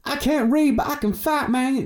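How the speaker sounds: very high, hammed-up American boxer accent; squeaky-high mock American boxer impression; Floyd Mayweather. Outdoing his first impression for his friend, exaggerated American boxer accent. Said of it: "I've done it even more".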